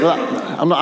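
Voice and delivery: ordinary talk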